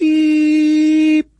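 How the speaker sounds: high, loud beep tone; imitated beep. Shown as a demonstration. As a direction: chirp a sharp radio beep. Imitating numbers station tone.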